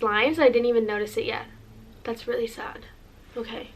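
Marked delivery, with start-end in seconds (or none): 2.0-3.7 hushed sad whisper; whispered aside, realizing it's the last time. Real, not acted. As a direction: say it under your breath, softly. Realizing these are last times.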